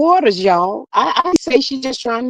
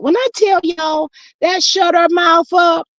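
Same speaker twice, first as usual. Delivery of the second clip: shout; 7 semitones higher, slower than usual. Real, not acted. Gossip, shouting the point.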